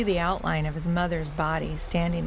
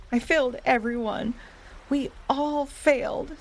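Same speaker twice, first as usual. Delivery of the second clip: acted tearful wail, raised pitch; sobbing; 6 semitones higher than usual. A narrator's reading. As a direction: wail it like an upset pup. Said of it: "who was also sobbing".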